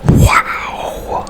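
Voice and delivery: ordinary delivery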